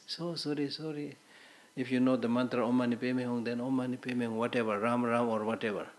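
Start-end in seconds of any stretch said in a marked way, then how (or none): 0.1-1.2 high, soft mock apology; perfunctory condolence. Teacher mimicking what we all say at deaths, mock sympathy. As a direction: murmur a token condolence in imitation.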